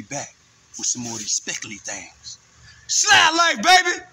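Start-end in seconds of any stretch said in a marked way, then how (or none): 2.9-4.0 high, loud sign-off yell; catchphrase shout. Real, not acted.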